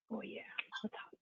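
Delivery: breathy whisper at hot food. Real, not acted. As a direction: whisper it under your breath. Tasting hot food on camera.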